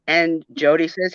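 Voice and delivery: ordinary delivery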